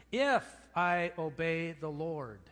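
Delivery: usual speaking voice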